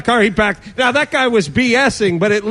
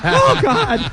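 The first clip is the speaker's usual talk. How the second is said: mock fanning gasp; 10 semitones higher than usual. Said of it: "Like they're".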